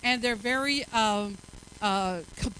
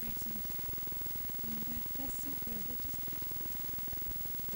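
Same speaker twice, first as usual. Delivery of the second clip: barely voiced, much softer mock timid praise; timid hush; 18 dB quieter, slower than usual. Shown as a demonstration. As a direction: show how weak, un-bold speech sounds. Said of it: "is that bold?".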